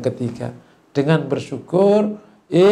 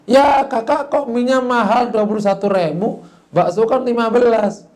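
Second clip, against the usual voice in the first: put-on higher-pitched child's protest; complaining younger sibling; 7 semitones higher, 3 dB louder than usual. Younger sibling comparing noodle and meatball prices, high-pitched complaint. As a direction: protest indignantly as the little sibling. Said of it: "adiknya bilang".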